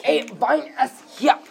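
high-pitched mock German accent. Shown as a demonstration. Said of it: "I can do a German accent".

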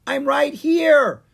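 very high, slow tiny voice; tiny newt voice. Acted. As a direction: squeak like a little newt.

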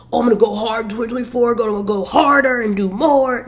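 mock hustle voice. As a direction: parody an overachiever hyping the year. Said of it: "I don't know what the fuck that voice just was".

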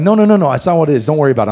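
fast, loud rush of denials; hurried reassurance. Retold or imitated. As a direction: hurry to calm him down.

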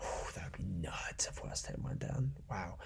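whispered stunned aside; hushed disbelief. Real, not acted. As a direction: breathe it out in disbelief. Stunned by prediction.

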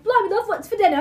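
high-pitched hammed-up British; mock British accent. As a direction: call out in cartoonish British. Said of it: "in a British accent".

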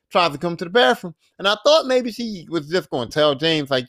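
normal talking voice

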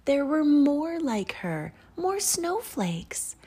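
high, thrilled storyteller reveal; excited discovery. A narrator's reading. Narrator to children as the snowflake finds others, excited. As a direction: lift it high with happy wonder.

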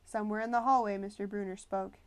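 plain reading voice

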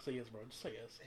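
softly egging him on; quiet, teasing nudge; coaxing hush. Real, not acted. Egging friend to eat wing.

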